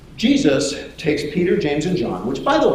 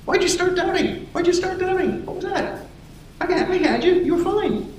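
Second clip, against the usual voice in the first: high, squeaky mock scolding; comic high-pitched fond reproach; chiding Jesus. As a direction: scold a friend in a put-on falsetto. Voices Jesus chiding Peter.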